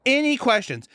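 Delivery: high, brisk classroom prompt; imitating teacher. Health teacher inviting questions from the class, high mock instructive voice. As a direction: pitch it up and toss it to the room. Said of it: "she's like".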